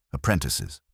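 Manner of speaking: ordinary delivery, nothing marked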